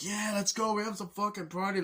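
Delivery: high, hyped-up party shout; mock clubgoer hype. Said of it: "and they're like".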